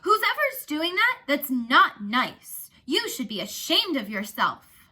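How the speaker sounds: loud, slow, scolding shout at the crowd. Acted. Narrator shouting at kids who mock Connor, angry scolding shout. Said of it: "I shouted at the group".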